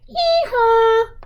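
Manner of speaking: high hee-haw donkey bray; playful mock donkey call. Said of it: "some donkeys say".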